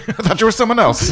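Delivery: high, quick, laughing apology. Real, not acted. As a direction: giggle through the excuse. Laughing apology mid-fight.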